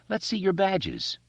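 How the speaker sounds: high, slow mock demand; playful challenge. A narrator's reading. Beverly playing the bandit game, high slow challenge. Beverly plays along from below.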